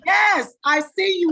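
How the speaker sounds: excited cheer. Real, not acted. Host cheering the guest on.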